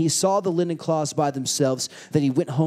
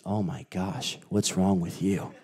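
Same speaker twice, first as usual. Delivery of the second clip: a lower, softer wife voice breaking into a laugh; 10 semitones lower, 6 dB quieter, slower than usual. Retold or imitated. Wife laughing at her exhausted husband mid-race, lower and softer. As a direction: tease him through a laugh. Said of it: "and she says".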